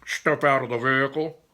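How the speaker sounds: loud officer's order over a police speaker; voice through speaker. Acted. Officer ordering the boys out, through a speaker. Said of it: "ordered Officer Spector through a speaker".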